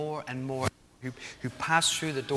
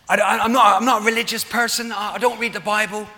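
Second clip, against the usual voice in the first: put-on louder, higher try at a Hinckley accent; attempted Hinckley accent; 7 semitones higher, 11 dB louder, faster than usual. Preacher as average Joe in the street, attempted Hinckley accent. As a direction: put on a rough Hinckley accent, pitched up and loud. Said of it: "I can't do a Hinckley accent, I'm sorry".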